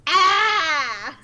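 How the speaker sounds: high, drawn-out mock scream. Co-host ending a cliffhanger message, hammed-up scream. Reacting to lightsaber cliffhanger in letter.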